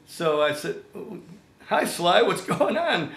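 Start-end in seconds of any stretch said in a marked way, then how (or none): 1.7-3.1 excited nervous laugh. Retold or imitated. Nervous call to his hero.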